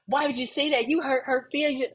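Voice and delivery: high, scolding whine of offended friends; mock offended friends. Friends scolding the honest one, mock offended whine. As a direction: play the friends, clutching pearls. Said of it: "Oh my goodness".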